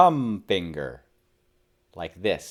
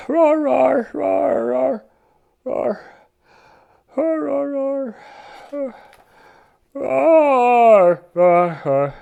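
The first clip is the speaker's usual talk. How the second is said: sleepy roars; 13 semitones higher, slower than usual. A narrator's reading. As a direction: roar as you run out of steam. Dinosaur tiring out.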